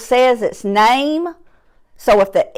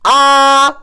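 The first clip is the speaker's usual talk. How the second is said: ordinary talk, nothing marked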